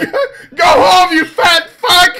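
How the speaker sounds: loud mocking heckle; shouting. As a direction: yell it like a heckler. Shouted heckle at imagined streamer.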